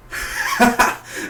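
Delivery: high burst of laughter; imitated laughing fit. Reviewer recalling watching the scene, high sudden laughter. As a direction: crack up as you did watching. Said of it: "I just started laughing".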